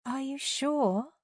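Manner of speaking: high, drawn-out mocking lilt; sing-song. A narrator's reading. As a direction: taunt slowly in a soft sing-song. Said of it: "asked in a sing-song tone".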